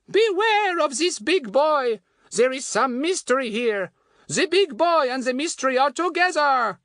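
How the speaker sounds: high, slow, ominous French warning; French woman's accent. Acted. Fatty re-enacting his warning to Goon, French woman's accent.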